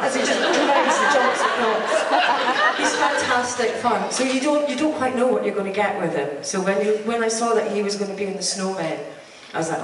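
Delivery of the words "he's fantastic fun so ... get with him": ordinary talk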